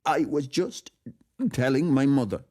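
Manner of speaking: high, emotional character voice; choked-up enthusiast, pitched high; overcome admirer. A narrator's reading. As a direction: gush with words catching, pitched well up. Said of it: "the catch in his voice".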